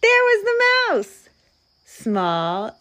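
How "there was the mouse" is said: high, loud squeal of surprise; startled storyteller, voice shooting up; shocked discovery. A narrator's reading. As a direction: gasp and cry it out in disbelief. Narrator's repeated shock at mouse.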